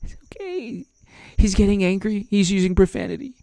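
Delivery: very high, hushed little voice; mock soothing media. Media covering for the angry president, high soft mock coo. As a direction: excuse him in a small falsetto coo. Media excusing Biden's temper.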